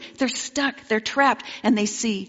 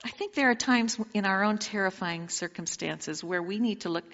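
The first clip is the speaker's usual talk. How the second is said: ordinary talk, nothing marked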